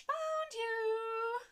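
high, loud gleeful call. Acted. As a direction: crow it like a winner. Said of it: "Gleeful voice called".

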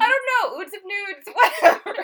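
high, loud, laughing shrug-off; high laughing brush-off; giggly high voice. Real, not acted. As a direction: giggle it away. Laughing off the joke.

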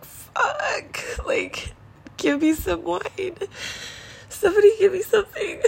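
high, tearful, half-whispered plea breaking into sobs; crying out for wine through tears after a hard phone call; desperate crying plea. Retold or imitated. Overwhelmed woman pleading to herself, crying. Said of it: "And I'm just like".

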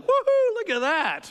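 high loud woohoo of delight; giddy mock cheer; excited whoop. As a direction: whoop like you found money. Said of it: "and you're like".

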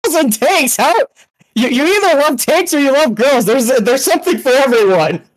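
high-pitched, incredulous protest; mock outrage. Real, not acted. Mock outrage at friend's lukewarm verdict.

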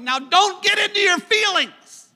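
high, loud shout at the congregation. Acted. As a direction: call it out across the room. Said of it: "follow that up by shouting".

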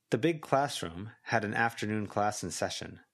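plain reading voice

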